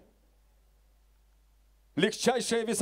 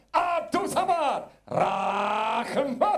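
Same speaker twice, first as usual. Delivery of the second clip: slow, drawn-out name call; ringmaster; 4 semitones higher, much slower than usual. Real, not acted. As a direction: call him out like a boxing MC. Ring announcer introduces fighter. (протяжно, как ринг-анонсер)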